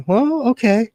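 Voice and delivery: usual speaking voice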